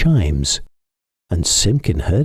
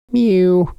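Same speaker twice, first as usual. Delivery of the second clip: high, loud mew; cat mew; 12 semitones higher, 3 dB louder than usual. A narrator's reading. Simpkin mews on the windowsill, high. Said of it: "scuffled Simpkin".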